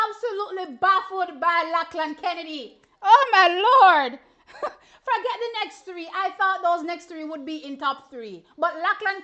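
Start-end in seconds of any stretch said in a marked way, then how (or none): 3.0-6.1 slow, loud, high laughing exclamation; real laughter at a surprise result; laughing disbelief. Real, not acted.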